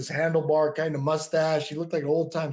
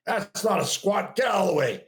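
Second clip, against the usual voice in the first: retold shout; 4 semitones higher than usual. Gym owner scolding the kid.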